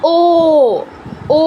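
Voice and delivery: ও held long and round; long vowel. Shown as a demonstration. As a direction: stretch each ও to two beats. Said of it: "বেশি time নিতে হবে". (টেনে লম্বা ও)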